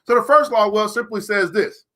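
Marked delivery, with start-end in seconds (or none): none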